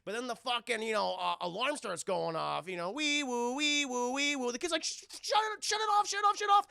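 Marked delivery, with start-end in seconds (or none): 2.9-4.5 high wee-woo siren noise; car alarm siren. Retold or imitated. Imitating the car alarm going off in the scrapyard. 4.8-6.7 much higher, stammering, rising to loud; frantic plea to kill the noise; panicked kid. Retold or imitated. Kid begging the giant to shut off the alarm, panicked.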